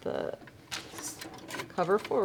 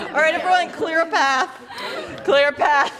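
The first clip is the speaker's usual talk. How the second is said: calling out; 9 semitones higher, 6 dB louder than usual. Real, not acted. Calling to the class.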